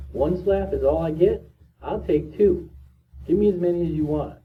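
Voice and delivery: high-pitched, imitated gung-ho readiness; eager bravado. Prisoner welcoming a slap to go home, acted eager bravado. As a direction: play a boy daring them to go on.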